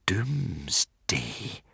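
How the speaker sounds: soft, slow, hushed horror; horrified whisper. A narrator's reading. Hiccup remembering the last battle, soft horror. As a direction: breathe the word in dread. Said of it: "whispered Hiccup in slow horror".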